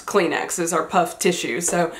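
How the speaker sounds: usual speaking voice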